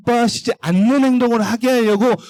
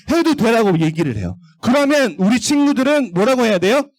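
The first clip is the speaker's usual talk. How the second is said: ordinary delivery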